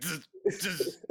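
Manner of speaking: electric buzz. Acted. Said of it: "short-circuiting a little bit".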